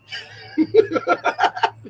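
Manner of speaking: breathy silent laugh, head thrown back; unvoiced laugh. Real, not acted. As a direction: laugh without voice at your own line. Laughs at own joke.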